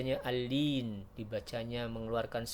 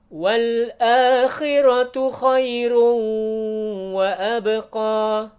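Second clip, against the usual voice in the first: chanted tajwid recitation, high and loud; Quran recitation; 10 semitones higher, 14 dB louder, much slower than usual. Shown as a demonstration. Teacher chants the verse for students in recitation style. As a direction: recite the verse in full melodic tartil.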